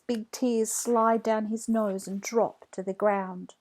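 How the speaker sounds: usual speaking voice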